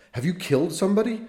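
startled priest demanding an answer; shocked question. Acted. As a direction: blurt it out in alarm.

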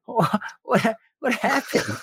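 high, puzzled mock girlfriend voice; girlfriend's confused voice. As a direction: play the bewildered girlfriend asking. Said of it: "She's like".

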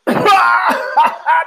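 sharp, high mocking laugh burst. Teacher laughing in a student's face, high mock laugh. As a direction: laugh right in her face. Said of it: "He starts laughing in her face".

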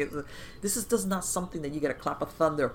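ordinary talk, nothing marked

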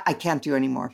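ordinary talk, nothing marked

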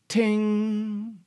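very high, bright ting like a pit pinging off; ping it out high like an olive pit; mock ting. Shown as a demonstration. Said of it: "so it tings across the room".

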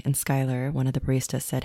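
plain reading voice

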